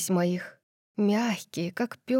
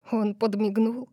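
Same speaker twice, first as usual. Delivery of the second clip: ordinary delivery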